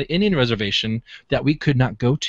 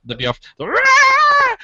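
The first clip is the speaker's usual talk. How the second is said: long drawn-out scream, much higher and slow; imitated Bigfoot scream; 5 dB louder, much slower than usual. Retelling the Bigfoot screams, a drawn-out imitated howl. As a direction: stretch it out like a distant howling cry. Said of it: "what we heard was the polyp screams".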